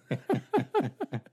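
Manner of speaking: high cackle at his own joke; high burst of laughter. Real, not acted. Teller mocking Miller's concert idea, high cackle.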